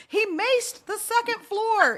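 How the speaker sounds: high, loud, drawn-out retold holler; mock frantic yell. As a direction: yell the news to your husband, hammed up. Frantic retold shout to husband.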